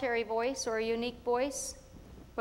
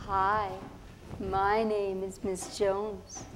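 lower, slow polite teacher voice; a grown-up greeting a new pupil, slowly; teacher Miss Jones; 4 semitones lower, much slower than usual. Retold or imitated. Teacher greeting Tere on the first day, lower and slow. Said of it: "She come up to me and she say".

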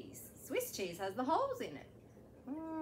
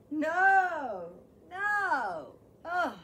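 loud refusal; 9 dB louder, much slower than usual. Acted. Caterpillar refuses food.

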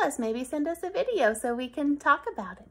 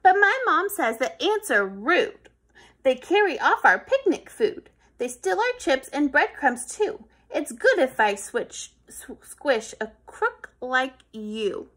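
a hint of a louder indignant boy; self-righteous kid; 8 dB louder than usual. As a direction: lecture the ant about picnics. Kid justifies squishing.

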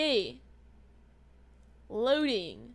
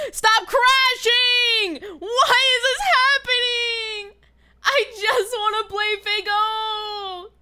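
frustrated crying yell; whiny cry-shout; 6 semitones higher, slower than usual. Real, not acted. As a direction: plead with the game through tears. Game keeps crashing.

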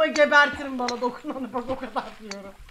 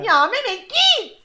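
high, loud yell that climbs sharply; exasperated yell; 8 semitones higher, 7 dB louder, slower than usual. Real, not acted. Player telling Mehmet to leave, exasperated yell. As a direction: yell to drive off a pest.

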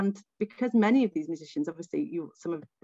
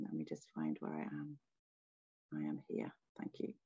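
quiet aside; 15 dB quieter than usual. Real, not acted. Muttering aside while finding her place.

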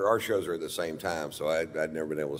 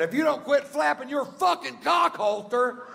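mock high insult. His insult quoted.

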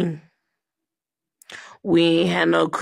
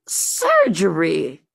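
ordinary talk, nothing marked

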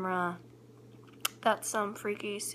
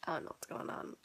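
whispering; 7 semitones lower, 7 dB quieter, faster than usual. Real, not acted. Home alone, speaking softly.

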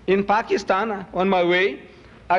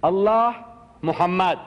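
slow, drawn-out reading aloud. Retold or imitated. Speaker re-enacts first reading the calligraphy, slow drawn-out reading. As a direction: sound out each name slowly. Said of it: "I'm reading".

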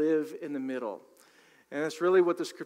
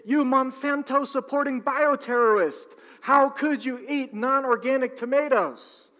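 higher, louder outraged accusation; mock outraged yell; 8 semitones higher, 6 dB louder than usual. The other half of the country yelling at the shopper, mock outrage. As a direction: hurl it like an activist scolding. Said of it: "the other half of the country starts yelling".

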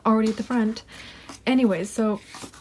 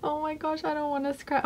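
nervous; 6 semitones higher, 6 dB quieter than usual. Real, not acted. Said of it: "I'm so nervous".

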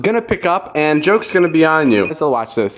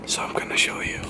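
whisper; 11 dB quieter than usual. Acted. Secret reveal in whisper.

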